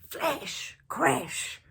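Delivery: ordinary delivery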